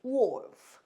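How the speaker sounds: wolf said as woo-oh-f, L barely sounded; English wolf without clear l. Shown as a demonstration. Teacher shows students how to say wolf, L barely sounded. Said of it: "우오 이렇게 돼요".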